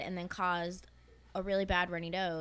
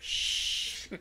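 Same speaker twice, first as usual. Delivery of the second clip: shushing. Retold or imitated. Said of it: "putting his finger over my mouth and saying".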